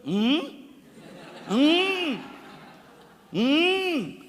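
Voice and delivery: rising high hums, a laugh in them; high mock hums with a laugh. A preacher imitating Peter humming instead of speaking, high laughing hums. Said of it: "Y Pedro".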